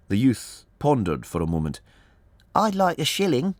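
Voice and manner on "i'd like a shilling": put-on high child's voice; little boy. A narrator's reading. Lad of six asks Holmes for money, little boy voice. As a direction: pipe up like a small lad.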